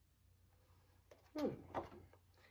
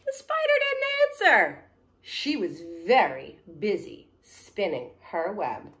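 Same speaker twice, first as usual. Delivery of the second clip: put-on story reading to children; 18 dB louder, slower than usual. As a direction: lead the kids through the refrain. Reads story refrain.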